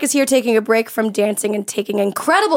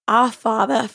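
mock Australian; slower than usual. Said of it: "Here is my Australian accent".

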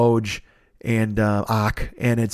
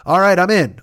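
casual shrug; 7 semitones higher, 6 dB louder than usual. Retold or imitated. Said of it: "everybody else is just like".

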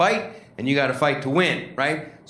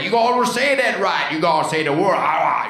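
mock Cajun accent, higher on the first line; 3 semitones higher, 3 dB louder than usual. Cajun corrects a tourist's diction in mock Cajun accent. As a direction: lecture him in a hammed-up Cajun accent. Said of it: "a Cajun".

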